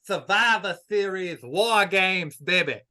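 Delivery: loud, high wrestling hype call; hype promo. Acted. Guest repeating the pay-per-view name to the host, in a loud hype call. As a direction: belt it out like a promo.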